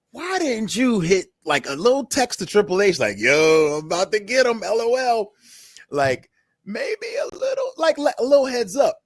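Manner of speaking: high, rising exasperated rant, slowing at the start; exasperated scolding. Retold or imitated. Host scolding the wrestler, high exasperated voice. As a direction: push it up into disbelief.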